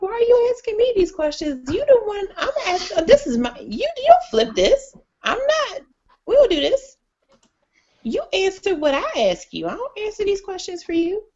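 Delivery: high, incredulous outburst; exasperated retort. Retold or imitated. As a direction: push back, pitched high and indignant. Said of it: "And I'm like".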